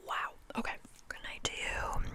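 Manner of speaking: ordinary delivery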